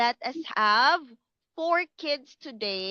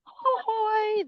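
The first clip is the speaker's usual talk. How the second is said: high, stretched mock yawn; acted yawn sound; 10 semitones higher than usual. Shown as a demonstration. Teacher acting out a yawn for the kids, high and hammed-up. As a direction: act out a big yawn. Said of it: "that is yawn".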